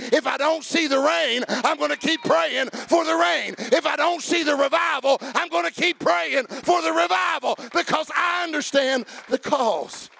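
insistent revival preaching, phrase on phrase; full-force preaching. Real, not acted. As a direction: preach it hard, climbing toward a shout.

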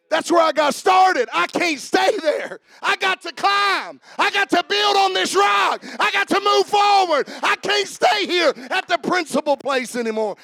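loud, high fiery preaching. Acted. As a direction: climb it up, shouting. Preaching climax.

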